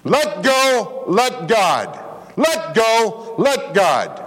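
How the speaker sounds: high, ringing call; urging Danny to surrender; pleading preacher. Retold or imitated. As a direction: lift it up like an urgent plea. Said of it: "And I said".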